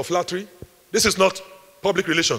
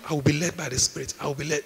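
soft, weak half-voiced confession; a mild mumbled prayer; timid mumble; 4 semitones lower, 7 dB quieter than usual. Shown as a demonstration. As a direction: say it meekly under your breath. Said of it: "That's not what we're talking about".

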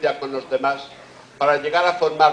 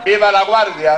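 loud high shout to the crowd; rally the crowd with a cry; festive cheer; 5 semitones higher, 8 dB louder than usual. Real, not acted.

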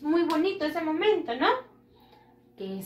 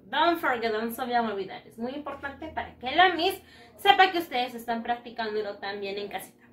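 usual speaking voice

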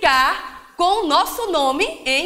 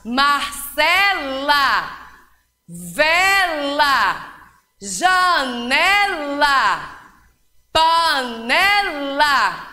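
Marcela, vela, janela, panela said slowly, leaning on the final -ela; teacher stretching the rhyming endings for children; stress on word endings; much slower than usual. Shown as a demonstration. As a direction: drag out each word so the -ela rhyme rings. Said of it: "Preste atenção no som final das palavras".

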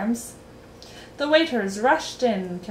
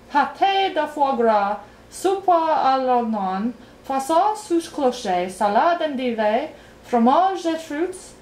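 higher, deliberately spaced French menu; careful; 5 semitones higher, 3 dB louder than usual. A narrator's reading. King rising to give the last speech, carefully. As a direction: pronounce each French dish with care. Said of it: "he said carefully".

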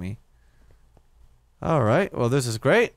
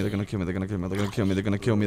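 usual speaking voice

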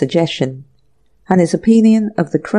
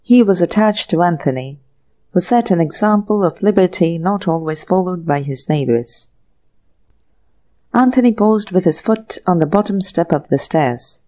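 plain reading voice

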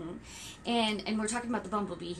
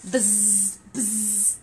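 lightly voiced bee buzz; 2 semitones lower than usual. Shown as a demonstration. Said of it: "Can you make a sound like a bee?".

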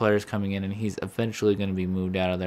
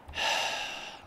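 fed-up sigh; 6 dB quieter than usual. Real, not acted. After cursing at the game.

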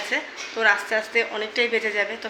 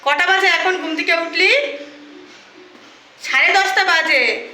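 scolding; 8 semitones higher, 5 dB louder than usual. Real, not acted. Mother scolds late-waking daughter.

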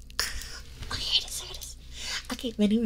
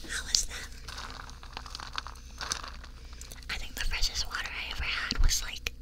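ASMR whisper; 4 dB quieter than usual. Acted.

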